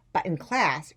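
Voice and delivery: ordinary delivery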